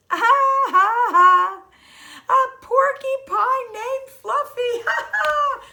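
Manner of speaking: loud, high laughter, almost shrieking; howling laughter. Acted. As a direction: howl with laughter at the silly name. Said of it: "howled the rhinoceros".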